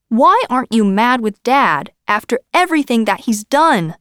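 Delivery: loud, high angry shout. A narrator's reading. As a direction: shout it at him. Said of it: "he shouted".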